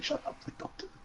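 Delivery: hushed talk to himself, barely voiced; muttered inner thought. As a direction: quote your silent plea under your breath. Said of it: "I was also just like".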